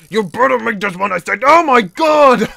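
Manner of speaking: insistent repeat building to a loud yell; exasperated warning. Real, not acted. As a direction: exasperation boiling over. Repeats warning, then fails.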